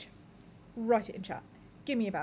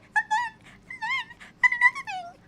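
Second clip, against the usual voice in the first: very high squeaky mock rant; 8 dB louder than usual. Mocking shouting people, very high squeaky rant. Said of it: "get kinda squeaky".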